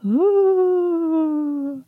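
high, drawn-out ghostly ooh; a mock spooky moan. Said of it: "my spooky voice just sounds like Tiffany".

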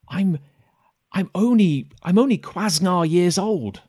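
put-on halting little-kid confession; bashful kid. Kid admitting his young age to a grown-up, high and halting. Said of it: "The kid".

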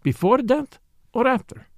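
high-pitched, pressing question; demanding. A narrator's reading. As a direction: press for which one it was. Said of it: "he demanded".

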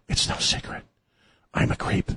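whispered creep's confession; creepy whisper. Acted. Host mimics Joe as a creep, creepy whisper.

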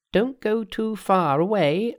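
high, drawn-out Monkey call. Acted. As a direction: call slowly after departing followers in a high voice. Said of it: "cried Monkey".

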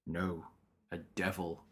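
quoting the captain. Retold or imitated. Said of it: "And the captain says".

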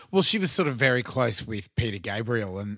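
low croaky voice; croaky, tired throat. Real, not acted. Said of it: "sorry about the croaky voice tonight".

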